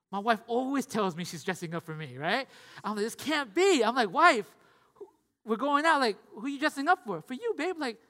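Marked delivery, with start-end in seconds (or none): 3.0-7.2 mildly hammed-up disbelief, higher and louder; acted incredulous challenge; incredulous husband. Acted disbelief in exchange with wife. 7.2-7.8 light, higher-pitched wife impression; mock wifely reply, high; imitated wife. Voices wife's answer to him.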